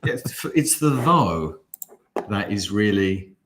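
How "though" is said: single out "though", drawn out slowly; stress on though. Shown as a demonstration. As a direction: dwell slowly on "though" as the quoted word. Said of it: "one of the poshest sounding".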